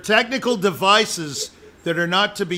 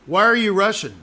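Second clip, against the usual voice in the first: raised, pointed question; accusing question; 3 semitones higher, 4 dB louder, faster than usual. Shown as a demonstration. Teacher retelling joke to student, pointed accusation. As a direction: ask it like catching someone out. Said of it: "I'm gonna say it again".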